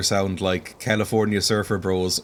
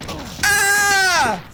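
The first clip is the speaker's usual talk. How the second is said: sudden high yelp of alarm; startled yelp; 4 dB louder than usual. Real, not acted. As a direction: cry out as the hit lands. Attacked by supposed friends.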